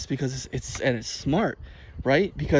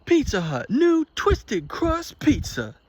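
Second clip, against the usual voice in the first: high, slow, over-the-top ad read; mock announcer voice; 11 semitones higher, slower than usual. Imitating the ad announcer to viewers, high and hammed up. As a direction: ham up the pizza announcer. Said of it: "it was like".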